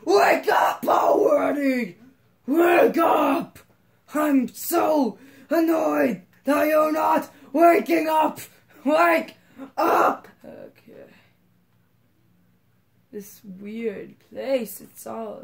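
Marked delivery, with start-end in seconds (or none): 0.1-10.3 high, loud mock yell at a sleeping Mario; hammed-up furious wake-up call; angry shouted voicing. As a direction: bellow it like an annoyed boss waking someone. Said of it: "He's like".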